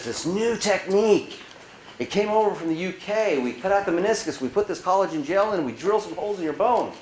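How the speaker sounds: a lightly voiced doctor explaining; imitating the doctor.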